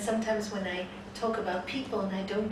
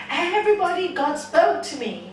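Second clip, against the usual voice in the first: loud, high, thrilled cry; excited announcement; 7 semitones higher, 9 dB louder than usual. Acted. Man calling all his friends, excited. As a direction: burst out with the big news. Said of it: "how excited you get".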